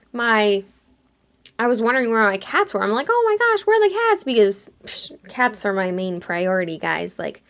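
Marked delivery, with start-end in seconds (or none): 3.1-4.2 high-pitched mock panic; dramatized alarm for a missing pet; frantic worry. Frantic about cats in retold dream.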